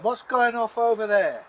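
ordinary delivery

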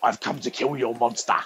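lightly voiced gruff Ray Winstone; a hint of a London hard man; mild Ray Winstone impression; Ray Winstone quote. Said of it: "he says that immortal line".

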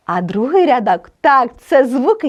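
ordinary talk, nothing marked

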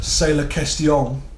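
slow, hammed-up French accent; best French accent. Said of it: "said [name] in his best French accent".

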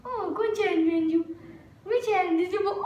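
high voice teasing a dog; playful pet talk. Real, not acted. Girl plays which hand with her dog, high teasing pet talk.

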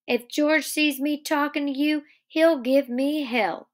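acted cautious worry, slowing at the end. A narrator's reading. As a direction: pitch it up, wary of trouble. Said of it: "Lenny said cautiously".

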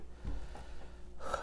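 short unvoiced exhale of defeat; pained sigh. Real, not acted. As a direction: let the air out in pain. Pepper burn.

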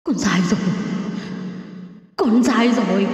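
tearful begging. A narrator's reading. Punished soul begging to be spared, tearful pleading. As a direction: beg like someone being whipped. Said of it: "kèm theo tiếng khóc van xin".

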